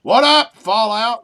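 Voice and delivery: big shouted hello to the room; loud greeting. Real, not acted. Streamer shouting hello to a friend joining. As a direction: call out a loud hey to a friend.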